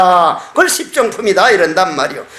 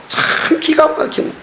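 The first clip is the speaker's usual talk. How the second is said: whispered astonishment; 5 semitones higher, 3 dB quieter than usual. Real, not acted. Astonished aside.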